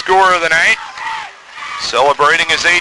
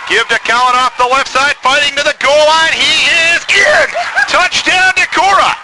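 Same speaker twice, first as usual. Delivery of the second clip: excited touchdown call; 7 semitones higher than usual. Real, not acted. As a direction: voice climbing higher and higher to a shout.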